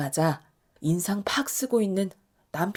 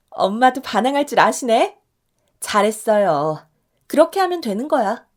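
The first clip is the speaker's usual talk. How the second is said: 신난 칭찬; 2 semitones higher, 7 dB louder than usual. Acted. 딸이 박수치며 칭찬.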